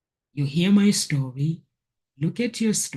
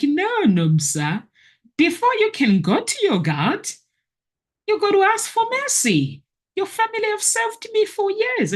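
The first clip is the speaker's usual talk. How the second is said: much higher, pleading stern rebuke; imitating a stern voice. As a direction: voice the one who scolds her, pitched high. Retells a voice rebuking her.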